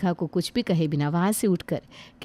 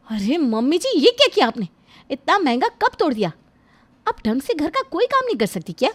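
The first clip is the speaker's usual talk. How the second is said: higher, scolding bahu over a broken cup; scolding daughter-in-law; 5 semitones higher than usual. Acted. Daughter-in-law scolding her mother-in-law for the broken cup, sharp and higher. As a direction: scold her like a careless child.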